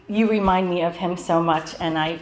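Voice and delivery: ordinary talk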